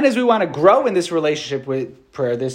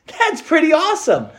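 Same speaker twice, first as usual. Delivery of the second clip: giddy delight; 14 semitones higher, slower than usual. Retold or imitated. Thrilled at being heard by Pope.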